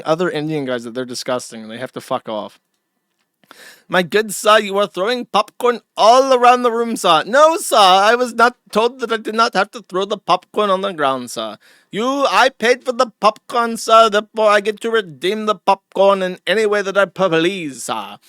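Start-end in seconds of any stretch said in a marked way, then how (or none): 3.9-7.2 higher, louder mock Indian accent; hammed-up Indian accent. It's Indian on Indian violence. 7.3-18.2 high, loud mock Indian accent protest; indignant hammed-up Indian accent; a louder, higher Indian accent. It's Indian on Indian violence.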